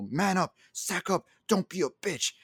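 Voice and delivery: imitating a friend telling him to toughen up; mock tough talk. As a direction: bark it like a coach who won't coddle. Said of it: "verbal tongue lashing".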